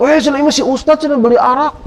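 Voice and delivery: high-pitched mock yell at the crowd; mock shout. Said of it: "Kemudian dia teriak".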